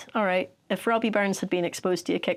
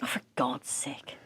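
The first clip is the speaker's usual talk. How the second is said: whisper; 6 semitones lower, 9 dB quieter than usual. Real, not acted. Mock exasperation at poem.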